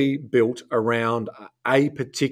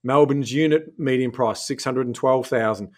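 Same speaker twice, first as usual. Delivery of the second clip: normal talking voice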